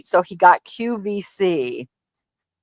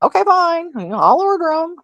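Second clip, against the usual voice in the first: a higher mock sigh of okay fine; exasperated giving in; 10 semitones higher than usual. Wife reluctantly agreeing to order her husband's candles, mock exasperated. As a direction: give in like you've lost the argument. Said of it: "So I'm like".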